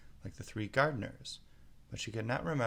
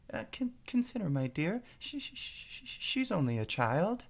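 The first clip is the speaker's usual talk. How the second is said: much higher, stammering nervously; a meek king barely daring; timid; 10 semitones higher than usual. A narrator's reading. King timidly pleads with his angry wife. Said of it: "timidly said".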